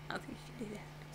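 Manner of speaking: fast hushed whisper. Real, not acted. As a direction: whisper it quickly aside. Aside while recording.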